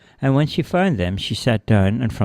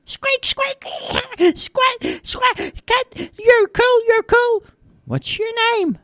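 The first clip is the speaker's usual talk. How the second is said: squeaky, very high mouse chatter; excited little mouse; 3 dB louder, much slower than usual. A narrator's reading. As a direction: squeal admiringly as a tiny mouse.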